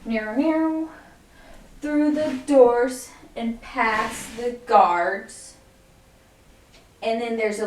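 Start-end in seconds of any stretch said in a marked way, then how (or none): 0.0-0.9 vocal "meow" guitar sound, higher; imitated guitar strum. Shown as a demonstration. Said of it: "So it goes". 1.8-5.5 very slow, drawn out to the moves; slow counted lyric. Shown as a demonstration. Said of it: "So it goes".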